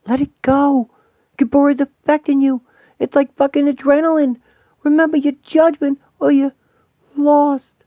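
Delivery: high, loud, urgent pleading; frantic plea. Acted. Begging an enraged friend to let go, frantic and high. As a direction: desperately pull her back from rage.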